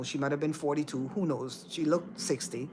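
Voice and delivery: ordinary talk, nothing marked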